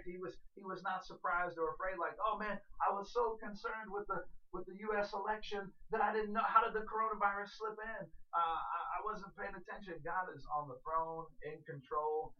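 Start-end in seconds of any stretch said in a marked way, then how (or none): 2.2-10.0 higher, louder flustered excuse; mock panic. Preacher imagining God caught unawares, mock panicked. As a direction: babble like someone caught off guard. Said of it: "like, panicked and taken back".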